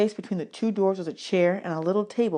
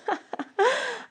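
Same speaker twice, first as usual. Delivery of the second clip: a short, high, bright chuckle; warm laugh; 4 dB quieter than usual. A narrator's reading. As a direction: laugh kindly at the boy's fear. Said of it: "She laughed and said".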